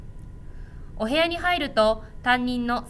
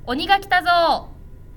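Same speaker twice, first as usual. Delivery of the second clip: high, loud, slow drawn-out call; 6 semitones higher, 4 dB louder than usual. A narrator's reading. Someone in the hall announces the ogres, loud call. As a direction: call out the ogres' arrival to scare the kids. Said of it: "という大きな声が".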